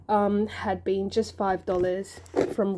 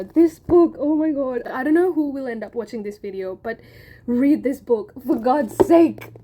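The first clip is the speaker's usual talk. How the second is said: high, loud pleading urge; excited; 3 semitones higher, 5 dB louder than usual. Real, not acted. Urging viewers to read her favourite book, excitedly. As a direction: beg them to read it.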